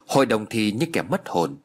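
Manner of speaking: ordinary delivery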